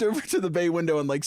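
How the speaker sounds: ordinary talk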